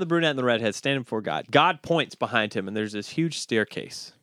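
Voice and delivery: ordinary talk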